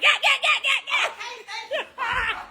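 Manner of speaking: high, shouted yeahs while laughing; fighting cries. Retold or imitated. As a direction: yell like a kid brawling. Imitating herself fighting.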